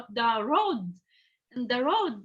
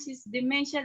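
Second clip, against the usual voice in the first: usual speaking voice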